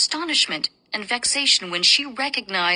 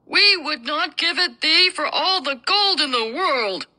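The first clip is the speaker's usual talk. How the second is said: elder dwarf, voiced higher; gruff dwarf voice; 6 semitones higher, 3 dB louder than usual. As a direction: speak as a stubborn old dwarf.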